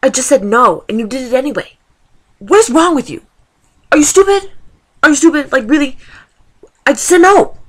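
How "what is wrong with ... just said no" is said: higher, louder, outraged at the thief; exasperated rant. Real, not acted. Venting at a coworker who stole her cigarette, angry raised voice. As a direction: let the frustration boil over into yelling.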